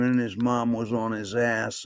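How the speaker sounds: ordinary talk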